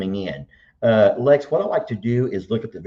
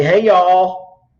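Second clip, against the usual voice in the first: loud, higher-pitched Southern hello; big greeting; 9 semitones higher, 9 dB louder than usual. Acted. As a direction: holler a drawn-out greeting. Dad voices a forbidden greeting.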